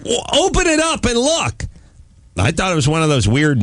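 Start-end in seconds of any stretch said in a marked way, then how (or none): 0.1-1.6 high, impatient retold command; exasperated order. Husband telling his wife to check the box, exasperated. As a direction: order her with pitch shooting up.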